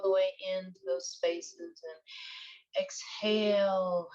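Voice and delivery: unhurried, even-toned breath coaching; guided meditation. Acted. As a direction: guide listeners through breathing, drawing out key words.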